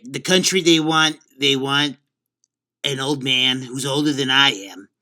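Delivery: mock-presidential New York accent; New York-accented political impersonation. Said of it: "your New York accent is, is kicking a little more in".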